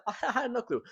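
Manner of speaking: amused aside, breaking into a laugh. Real, not acted. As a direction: chuckle at your own cluelessness. Laughs recalling his cluelessness.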